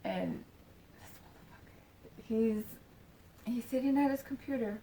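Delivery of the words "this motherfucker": hushed whisper. Retold or imitated. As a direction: whisper the insult under breath. Hushed reveal of the friend.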